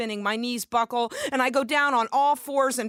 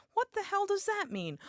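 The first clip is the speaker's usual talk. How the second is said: baffled; 11 semitones higher, 5 dB quieter than usual. Retold or imitated. Baffled by lyric.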